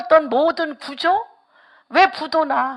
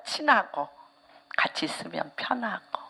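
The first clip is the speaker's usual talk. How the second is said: much softer whispered, tender; 5 semitones lower, 11 dB quieter than usual. Real, not acted. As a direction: whisper it like a comfort. Tender hushed aside. (부드럽게 속삭이며)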